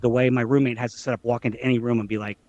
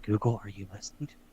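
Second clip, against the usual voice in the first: hushed, quick question in a near-whisper; mock whisper; 3 semitones lower, 10 dB quieter, faster than usual. Roommate secretly checking on the smart speaker, mock whisper. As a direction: lower your voice and ask it on the sly. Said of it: "sometimes I'll whisper".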